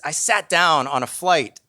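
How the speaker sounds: ordinary delivery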